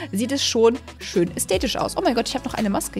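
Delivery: normal talking voice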